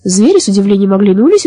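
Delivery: usual speaking voice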